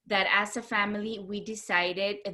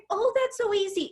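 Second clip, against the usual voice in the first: high, airy and carefree; tutor's breezy reply; 11 semitones higher than usual. Retold or imitated. Architect tutor shrugging off algebra to a struggling teen, high and breezy. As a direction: toss it off as if it's nothing.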